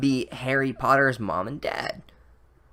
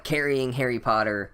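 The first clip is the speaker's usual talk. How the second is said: usual speaking voice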